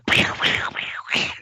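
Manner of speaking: mouth-made video game explosion noises; imitated explosions. Hearing a game in the computer store, imitated explosions. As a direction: imitate arcade blasts and zaps.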